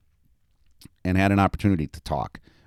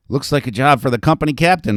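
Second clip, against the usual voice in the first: joking; 7 semitones higher, 7 dB louder, faster than usual. A narrator's reading. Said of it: "Marks joked to Mowry".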